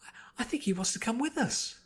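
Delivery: high breathless whisper; timid whisper. A narrator's reading. Fluttershy timidly asking to keep the wolf, whispered. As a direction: ask shyly, barely voiced.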